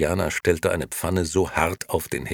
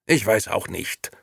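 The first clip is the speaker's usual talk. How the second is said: higher voice, mildly irritated; 6 semitones higher, 4 dB louder than usual. A narrator's reading. Nino fends off Momo's question, irritated. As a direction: answer with irritation. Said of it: "sagte Nino gereizt".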